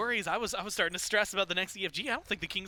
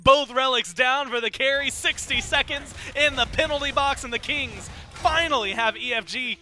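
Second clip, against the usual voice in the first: loud, raised esports shoutcasting; play-by-play; 6 semitones higher, 11 dB louder than usual. Real, not acted. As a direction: call the team fight at full excitement. Live play-by-play at a fight.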